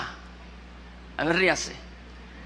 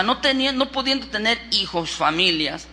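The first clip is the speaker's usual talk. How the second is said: normal talking voice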